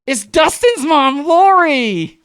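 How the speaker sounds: loud, high, drawn-out mock-mom voice; gleeful announcement. Mom revealing herself to the girlfriend, gleeful. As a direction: imitate mom's singsong big reveal.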